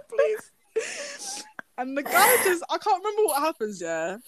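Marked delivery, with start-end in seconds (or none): 0.1-1.4 high, laughing please; pleading with a laugh. Retold or imitated. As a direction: squeak it out through laughter. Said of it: "I was like".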